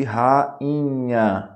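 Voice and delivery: rainha slowly broken into ra-i-nha; hiatus split apart. Shown as a demonstration. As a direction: hold the separate i in rainha. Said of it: "o I não pode ser seguido de N H".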